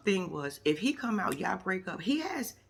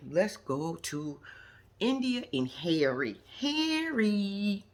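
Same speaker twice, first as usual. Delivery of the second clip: slow announcer voice; much slower than usual. Real, not acted. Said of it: "announcing next couple".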